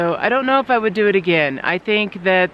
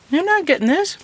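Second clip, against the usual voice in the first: talking to a dog; 11 semitones higher than usual. Real, not acted. Teasing the dog over food.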